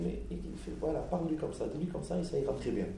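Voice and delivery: softly voiced grandma, hushed and simple; grandmother's words. Grandmother gently advising, a soft hushed voice. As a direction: keep it soft and plain like a grandmother. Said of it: "elle te dit".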